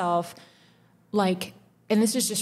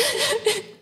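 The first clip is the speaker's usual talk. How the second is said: teary laugh. Real, not acted. Teary laugh mid breakdown.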